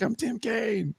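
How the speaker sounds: a high, strained mock outburst; hammed-up fed-up senator; mock exasperation. Tim Kaine frustrated that nobody knows his name, mock exasperated. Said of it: "Tim Kaine loses his shit".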